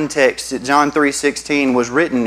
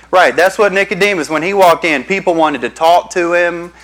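normal talking voice

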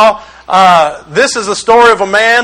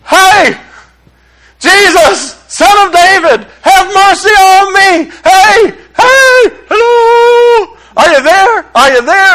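high, loud call for help; yelling out; 10 semitones higher, 3 dB louder, slower than usual. Acted. Blind man yelling out to Jesus for mercy, high and desperate. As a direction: yell out to someone passing, pitched way up. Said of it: "He started yelling out".

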